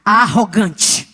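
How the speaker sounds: booming, drawn-out divine accusation, pitched low; imitating a mighty voice speaking in the ear. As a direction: stretch it out slowly as a solemn voice from above. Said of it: "veio uma voz no meu ouvido assim".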